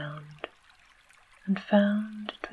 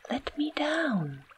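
pitched high, a scream held inside a whisper; 9 semitones higher than usual. A narrator's reading. Girl to giant, begging to be put down, whispered high scream. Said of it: "screamed the girl".

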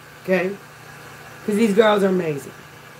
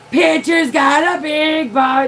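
high, drawn-out mock yell; retold screamed cheer; 6 semitones higher than usual. Coach cheering at her pitcher, retold screamed cheer. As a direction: holler it slowly like a sideline chant, not full scream. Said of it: "I'm, like, screaming it".

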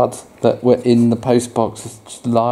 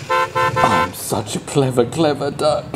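boastful Duck. Acted. Parent voicing Duck boasting to the child. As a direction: crow about yourself.

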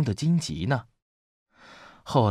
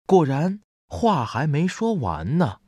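ordinary delivery

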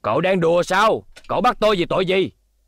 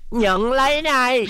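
high, slow battle cry; fighting shout; 8 semitones higher, slower than usual. Acted. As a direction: throw the blow with it. Attacking in a fight. (hét lên khi tấn công)

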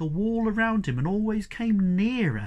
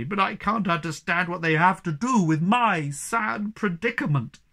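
a touch of bitter sarcasm; mildly bitter; bitterly; 3 semitones higher, 6 dB louder than usual. A narrator's reading. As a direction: sneer at his stories. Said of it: "said the Hemulin bitterly".

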